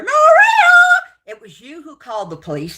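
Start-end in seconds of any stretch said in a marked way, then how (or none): 0.0-0.7 screamed name, much higher and louder; furious yell. Real, not acted. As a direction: scream her name in pure rage. Shouting at Maria during rant.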